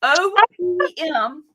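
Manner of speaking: O P M drawn out slowly in a Southern drawl; Southern accent. Shown as a demonstration. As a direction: stretch O P M Southern-style so it sounds like opium. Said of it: "it's my Southern accent".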